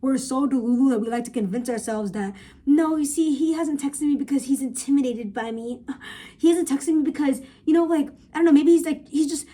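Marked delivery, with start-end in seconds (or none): 2.6-9.5 making excuses. Retold or imitated. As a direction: insist on it like a deluded friend. Voicing women's delusional excuses.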